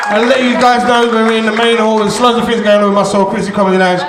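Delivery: loud MC shouting over the room, high to start; announcer sign-off. Real, not acted. Comic closing his set for the audience, loud announcer call. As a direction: project a big, loud farewell to the crowd.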